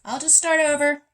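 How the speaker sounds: high, loud out-of-character outburst; breaking off loudly. Real, not acted. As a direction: drop the meditation voice and call out high and loud. Breaks off the take to restart.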